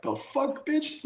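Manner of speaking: high, startled "the fuck"; shocked outburst. Retold or imitated. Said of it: "And I'm just like".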